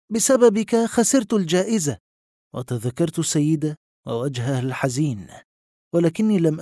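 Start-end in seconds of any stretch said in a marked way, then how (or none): 0.1-2.0 higher, sharp accusing voice; angry tone. A narrator's reading. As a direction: blame him angrily. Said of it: "بنبرة غاضبة".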